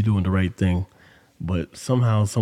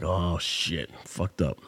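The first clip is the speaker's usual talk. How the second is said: quiet; 3 semitones lower, 8 dB quieter, slower than usual. Retold or imitated. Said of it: "I'm like".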